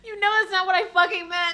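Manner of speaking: loud high words through laughter; exasperated laughing outburst; laughing protest. Real, not acted. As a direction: complain while laughing. Laughing at the game.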